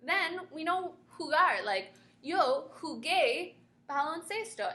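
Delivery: ordinary talk, nothing marked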